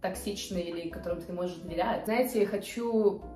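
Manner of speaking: ordinary talk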